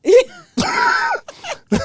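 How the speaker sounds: very high, loud squeal of laughter. Real, not acted. Actor cracking up at his audition story, squealing laugh. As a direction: burst into a shrieking, high laugh.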